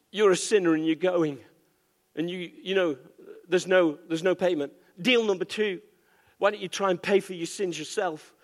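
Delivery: ordinary talk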